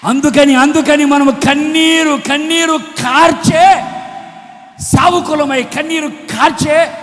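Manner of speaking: loud, slow, drawn-out proclamation easing at the end; impassioned preaching. Real, not acted. Preacher calling believers to weep in prayer, impassioned preaching. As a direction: stretch each key word out to the crowd.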